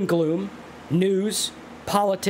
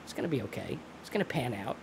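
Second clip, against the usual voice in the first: soft reassurance; 2 semitones lower, 9 dB quieter than usual. Pretend. Soothing self-talk to calm worry.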